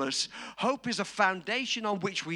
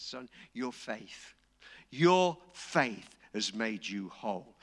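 tender blessing; 8 semitones lower, slower than usual. Retold or imitated. Jesus telling the healed blind man his faith healed him, tenderly. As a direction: lay it on him gently.

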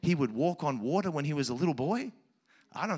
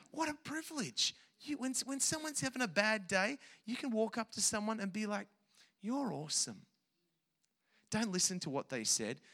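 much softer, gentle coaxing; tender encouragement; 3 semitones higher, 8 dB quieter than usual. Real, not acted. Pastor encouraging the kids to build others up, soft and tender.

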